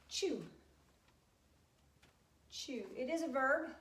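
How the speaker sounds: ordinary talk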